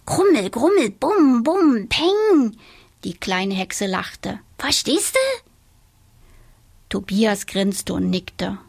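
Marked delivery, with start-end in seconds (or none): 0.1-2.6 high, loud rumbling thunder noises; imitated thunder. Donatella imitating her grandfather's thunder, loud. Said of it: "so richtig lauten Donner". 4.6-5.5 very high, playful witch; giggly little witch. A narrator's reading. Donatella after her joke, very high and playful.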